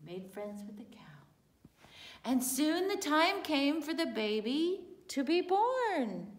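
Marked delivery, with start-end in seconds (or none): 0.1-1.8 soft secret whisper. Acted. Storyteller telling children the donkey befriends the cow, whispered. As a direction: hush it down to a whisper.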